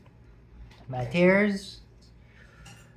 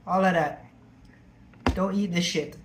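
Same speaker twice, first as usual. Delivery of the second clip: ordinary talk, nothing marked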